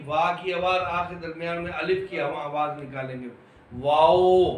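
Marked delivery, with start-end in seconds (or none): 3.7-4.6 वाओ with lips rounded, a full w; वाओ said with fully rounded lips. Shown as a demonstration. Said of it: "हम होंठों को गोल करेंगे".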